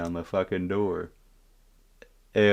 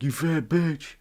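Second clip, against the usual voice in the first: mock yell; 8 semitones higher, slower than usual. Friend taunts the moms from the balcony, mock yell. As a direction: imitate a slow taunting call. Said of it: "my friend yells out".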